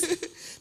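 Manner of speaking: short airless giggle; a quick giggle; breathy host giggle. Real, not acted. Host giggling at the funny question, breathy giggle. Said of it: "you really need to laugh".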